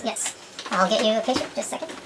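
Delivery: usual speaking voice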